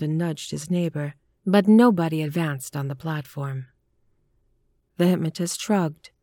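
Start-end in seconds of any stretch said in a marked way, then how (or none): none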